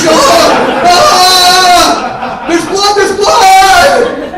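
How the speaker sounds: high, loud stretched-out shout; panicked shout. Retold or imitated. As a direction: scream it like a terrified kid. Kids spraying hose, panicking.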